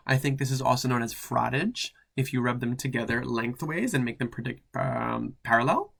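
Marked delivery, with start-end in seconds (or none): none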